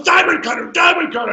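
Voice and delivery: high, shouted insistence; insistent shout. Retold or imitated. Eddie yelling his finisher at partner mid-match, insistent shout. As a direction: repeat it like he won't take no.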